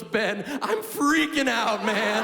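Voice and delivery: slow, stretched, panicky stoner voice; panicked dad. Retold or imitated. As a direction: drag out the panic like a tripping father.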